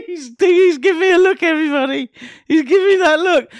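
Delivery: giggly teasing. Real, not acted. Laughing tease.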